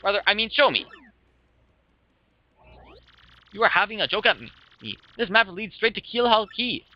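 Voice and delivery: indignant Flavio. Acted. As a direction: accuse them like an affronted, pompous adventurer. Plays a pompous treasure hunter in game.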